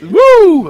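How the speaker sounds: high, loud wrestler woo; Ric Flair woo. Retold or imitated. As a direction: let out a piercing wrestler whoop. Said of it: "is that Ric Flair".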